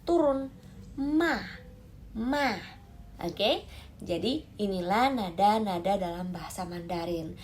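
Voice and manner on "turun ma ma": TURUN and MA starting high and falling; "Ma" high, then plunging down; falling fourth tone. Shown as a demonstration. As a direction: drop MA firmly from a high start. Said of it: "kalau nada empat".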